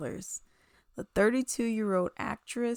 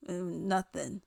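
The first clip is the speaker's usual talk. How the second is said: ordinary talk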